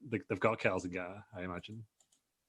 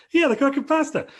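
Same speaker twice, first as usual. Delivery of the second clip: much higher, louder mock announcer; high, loud mock commentator echoing his partner; Michael Cole impression; 5 dB louder than usual. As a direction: squeak it out like a giddy commentator. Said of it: "And Michael Cole's like".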